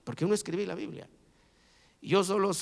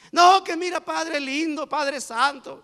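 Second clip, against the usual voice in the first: mock pleading; 9 semitones higher, 6 dB louder, slower than usual. People begging God, mocked.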